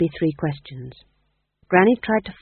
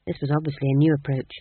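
ordinary delivery

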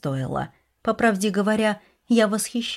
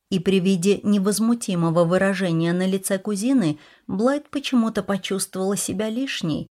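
ordinary delivery, nothing marked

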